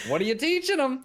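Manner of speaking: high-pitched mock reproach; mock dismay. Husband to wife after niece copies her, high mock dismay.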